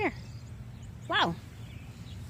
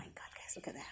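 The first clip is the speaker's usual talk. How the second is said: awed whisper; 18 dB quieter than usual. Real, not acted. Awed gardener hushes over a bloom.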